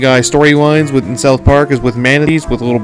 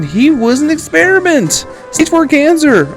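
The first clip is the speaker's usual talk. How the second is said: high-pitched excited telling; excited recap; 11 semitones higher than usual. Real, not acted. Host recapping Deadpool's origin to listeners, excited. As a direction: push the pitch right up as the facts pile up.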